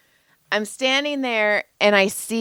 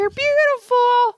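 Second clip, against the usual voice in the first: very high, slow squeaky praise; hammed-up cooing compliment, voice way up; mock gushing; slower than usual. Joy reassures co-host who looks trashy, mock gushing.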